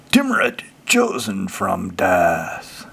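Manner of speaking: grand, stretched-out title reveal; dramatic card announcement. Acted. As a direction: unveil the name theatrically, slowing right down.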